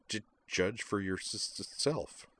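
soft, stammered tease; stuttering with a smile. Acted. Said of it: "said Kate with a smile".

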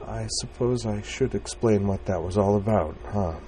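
shy, fragile attempt at explaining; softly wavering voice. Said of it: "in a softly wavering voice".

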